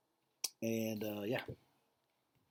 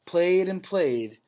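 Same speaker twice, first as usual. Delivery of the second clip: solemn, louder read-aloud voice; story narration; 5 semitones higher, 12 dB louder than usual. A narrator's reading. Teacher reading a Holocaust story to the class, grave narration. Reads picture book to class.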